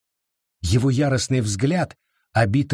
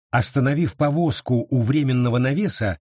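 ordinary delivery, nothing marked

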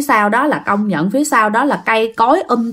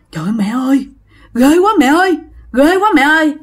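panicked; 4 semitones higher, faster than usual. Acted. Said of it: "mặt của anh Khánh hớt ha, hớt hải".

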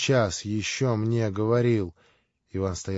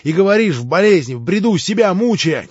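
louder, much higher, pained; anguished cry; 8 semitones higher, 10 dB louder than usual. Acted. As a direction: cry out at his brother's torment. Alyosha pleading, distraught. (с болью, громко)

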